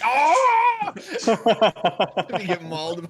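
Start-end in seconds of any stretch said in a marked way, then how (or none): 0.0-2.3 high, loud startled cry with laughter; mock scream. Player retelling friend's panicked cry, mock scream. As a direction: yelp like you just got mauled. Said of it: "and then you're like".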